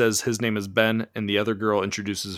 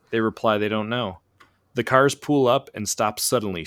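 usual speaking voice

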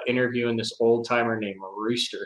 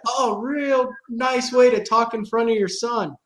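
high, slow, snide rebuke; mock them with fake politeness; sarcastic boater voice; sarcastic scold; 10 semitones higher, 4 dB louder than usual.

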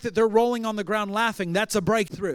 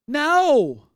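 sharp, loud and high "No"; emphatic no; 5 semitones higher, 7 dB louder than usual. Real, not acted. Preacher rejecting a religious excuse, firm loud no. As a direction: cut the excuse off hard.

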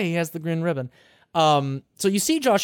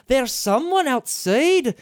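put-on Scottish girl voice; 7 semitones higher, slower than usual. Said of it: "the Scottish accent".

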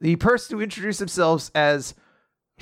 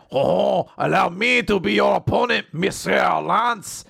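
put-on French accent; 3 semitones higher than usual. Challenger addressing his opponent Lance, hammy French accent. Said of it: "is apparently very French".

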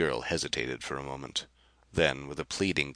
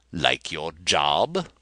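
gloating; 2 semitones higher, 6 dB louder, much slower than usual. Acted. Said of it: "Rapaju was gloating".

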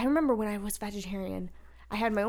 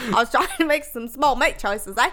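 louder, teasing Aussie put-on; mock Australian accent; 3 semitones higher, 9 dB louder than usual. Teasing her friend in a loud mock Aussie accent.

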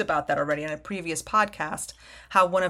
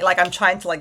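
ordinary talk

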